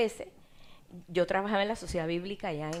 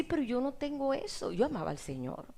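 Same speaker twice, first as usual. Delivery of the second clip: usual speaking voice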